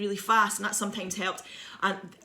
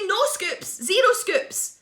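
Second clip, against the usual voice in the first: high, squealed mock plea; panicked refusal; 12 semitones higher, 5 dB louder, much slower than usual. Child begging dinner lady for no pie, high panicked voice. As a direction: refuse in hammed-up panic, pitched way up.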